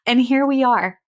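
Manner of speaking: ordinary talk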